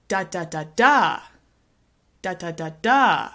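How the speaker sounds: three quick short "da, da, da", then one long, loud "da"; stress on last syllable. Shown as a demonstration. Teacher showing stress at the end, final "da" stressed. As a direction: hurry to the final "da" and punch it. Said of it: "put the stress at the end".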